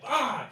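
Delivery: sudden high, loud "Ah!"; startled yelp. Retold or imitated. Jumpy moviegoer turning on the friend behind, startled yelp. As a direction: yelp as if about to be attacked.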